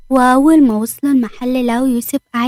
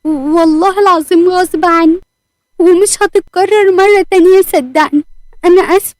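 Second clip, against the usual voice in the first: high, urgent begging apology; tearful pleading; 6 semitones higher, 4 dB louder than usual. A narrator's reading. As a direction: plead with a higher, raised voice. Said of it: "هدير كانت بتعيط".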